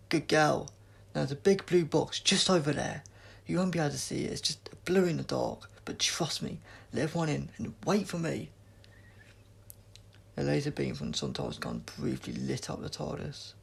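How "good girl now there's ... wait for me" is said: loud, urgent instructions; Doctor shouting orders to Jess; calling out. A narrator's reading. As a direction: call across the dark room.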